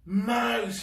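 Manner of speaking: high loud joyful cry. Acted. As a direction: shout a friend's name in relief. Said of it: "he cried".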